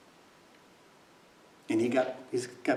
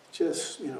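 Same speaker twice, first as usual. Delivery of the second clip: ordinary talk, nothing marked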